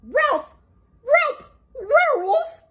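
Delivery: high barking cries; Scooby crying out. Acted. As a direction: bark the alarm like a dog. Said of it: "Scooby-Doo cried".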